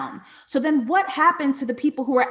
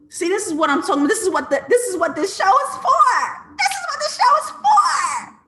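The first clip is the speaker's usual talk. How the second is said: rising higher and louder into a laughing squeal; excited; 9 semitones higher, 4 dB louder than usual. Real, not acted. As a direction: build up until you're laughing with delight at the show. Thrilled outburst about the show.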